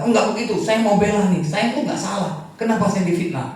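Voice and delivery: high, loud indignant plea; pleading protest. Pretend. Slandered employee insisting on his innocence to the boss, high and indignant.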